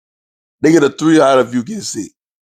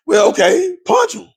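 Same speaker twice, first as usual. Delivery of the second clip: mocking dismissal; 13 semitones higher, slower than usual. Voicing the uncaring side.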